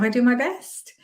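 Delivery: "best" rising high like a question; questioning inflection. Shown as a demonstration. Coach showing self-doubt, "best" rising as a question. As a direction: lift "best" up as if unsure. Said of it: "that's like questioning yourself".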